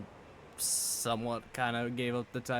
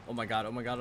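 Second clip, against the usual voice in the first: usual speaking voice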